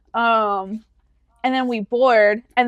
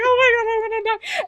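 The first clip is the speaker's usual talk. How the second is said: very high, squeezed mock-terrified voice; squealed panic; 11 semitones higher than usual. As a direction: squeak it out in comic terror. Acting out panic in turbulence.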